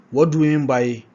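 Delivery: usual speaking voice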